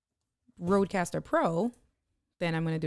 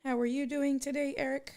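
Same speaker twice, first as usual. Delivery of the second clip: pitched way up, friendly hello; excited greeting; 8 semitones higher than usual. Real, not acted. Greeting Eric who joined the stream, excited and high. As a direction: sing out a welcome to a friend.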